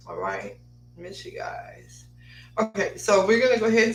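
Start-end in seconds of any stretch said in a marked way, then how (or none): 0.1-2.0 whispered aside. Real, not acted. Whispered aside to absent friends.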